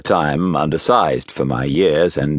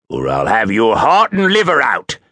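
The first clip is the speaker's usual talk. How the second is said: threat swelling higher at the end; menacing threat; 5 semitones higher, 3 dB louder than usual. A narrator's reading. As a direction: threaten the child with rising force. Convict threatens the boy.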